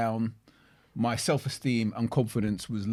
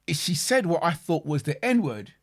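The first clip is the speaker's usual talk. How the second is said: ordinary talk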